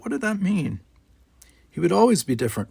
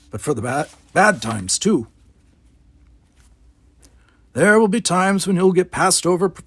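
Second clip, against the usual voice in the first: louder, higher, grave advice; stern old gardener warning; 6 semitones higher, 4 dB louder than usual. Acted. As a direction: warn him about the hard times.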